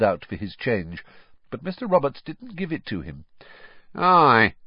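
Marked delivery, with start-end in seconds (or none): 3.9-4.5 thoughtfully. A narrator's reading. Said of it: "he said thoughtfully".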